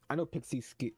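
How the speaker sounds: ordinary talk, nothing marked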